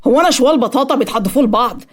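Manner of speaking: understated angry shout; indignant yell. A narrator's reading. Patient yelling for his mother over being passed around, indignant. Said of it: "صرخ آسر وهو بيندى على والدته".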